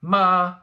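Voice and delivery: long, loud, high level MA; first tone. Shown as a demonstration. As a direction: sing out MA on one high, flat pitch. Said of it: "Let's review one more time".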